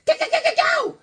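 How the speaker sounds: very high cheer. Real, not acted. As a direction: cheer her on. Excited cheering.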